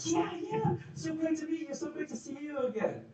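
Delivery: excited greeting. Retold or imitated. Meeting somebody when you're both excited, high gushing greeting. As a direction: greet them like you're thrilled to see them. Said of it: "you guys are both excited".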